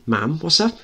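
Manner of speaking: put-on high young boy voice; child voice. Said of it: "Mickey speaking".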